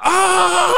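high imitated scream of a shocked man; mock scream. Retelling the drunk man's scream under the shocks. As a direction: yelp it out as if jolted awake. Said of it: "grita el señor".

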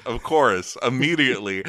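usual speaking voice

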